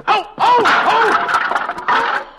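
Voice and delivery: high, loud yelps of alarm; panicked oh as he falls; startled cry. Acted. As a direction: cry out as the floor gives way under you. Falls through the ceiling.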